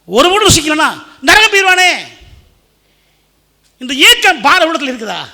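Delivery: very high, loud exhortation; shouted, impassioned sermon climax; full-force preaching. Real, not acted. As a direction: cry out to the congregation with burning urgency.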